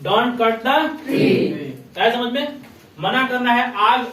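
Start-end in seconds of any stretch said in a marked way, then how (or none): 0.1-2.0 very slow, word by word for the class; drawn-out teacher's model line; slow model sentence. Shown as a demonstration. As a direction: spell it out slowly so pupils repeat. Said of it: "तो क्या बोलिएगा?".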